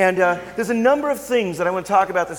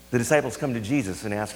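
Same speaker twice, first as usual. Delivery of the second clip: ordinary talk, nothing marked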